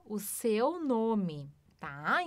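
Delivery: usual speaking voice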